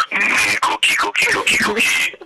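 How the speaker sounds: Cookie Monster voice. Pretend. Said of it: "Do your best Cookie Monster in, impression".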